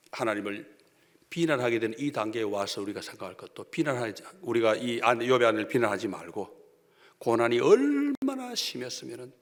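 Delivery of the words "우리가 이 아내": normal talking voice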